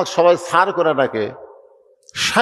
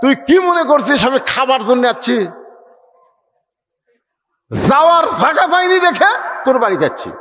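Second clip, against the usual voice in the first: angry shout; 6 semitones higher than usual. Acted. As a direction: bellow at him, wounded and angry, rushing the end. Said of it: "চিল্লাই বলতেছে". (ছেলের উপর বাবার রাগী চিৎকার)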